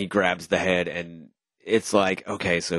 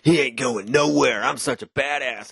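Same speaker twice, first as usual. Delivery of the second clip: higher, smug tough-guy voice; cocky henchman; 7 semitones higher than usual. Retold or imitated. Soldier bragging after hooking the hero, smug and higher. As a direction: brag like a self-satisfied goon. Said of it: "and then he's like".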